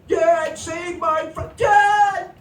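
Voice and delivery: put-on loud, high wailing child's shout; a kid wailing at his dad to save the frog; hammed-up crying plea. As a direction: wail like a melodramatic kid. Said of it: "little droopy face on the kid with the sad".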